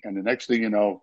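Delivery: ordinary talk, nothing marked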